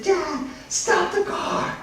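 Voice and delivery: put-on high, drawn-out child's squeal; very high, slow kid voice; excited little boy. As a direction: pipe up as a thrilled small son. Excited small son calls out.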